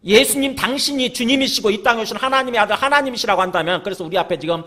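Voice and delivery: impassioned pulpit delivery; full-force preaching. Real, not acted. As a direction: preach it out to the congregation.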